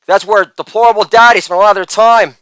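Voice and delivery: ordinary talk, nothing marked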